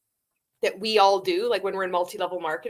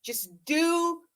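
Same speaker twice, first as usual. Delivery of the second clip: mocking the guru; 4 semitones higher, 6 dB louder than usual. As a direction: ham up a smug coach preaching obedience. Mocking Randy's self-important pitch.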